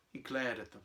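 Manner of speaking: plain reading voice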